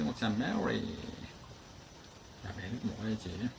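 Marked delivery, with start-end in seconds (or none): none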